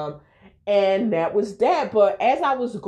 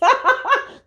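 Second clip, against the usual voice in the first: laugh; 5 dB louder than usual. Real, not acted. Laughing while retelling his invite.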